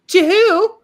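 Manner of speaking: high, loud and disbelieving; incredulous retort. Real, not acted. Reacting to her friend's boast, high, loud disbelief. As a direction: scoff it back in disbelief.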